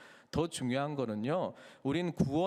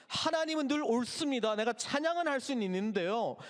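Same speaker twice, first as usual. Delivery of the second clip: higher, pleading prayer; frustrated believer's prayer; 7 semitones higher than usual. Acted. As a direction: beg God to show goodness.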